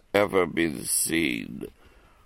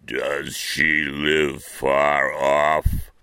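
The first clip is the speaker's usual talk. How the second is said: wolf; 2 semitones lower, much slower than usual. Acted. Wolf questions the girl.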